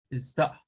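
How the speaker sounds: British-style stop; stop demo. Shown as a demonstration. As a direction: stop clipped short. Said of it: "No suena la R".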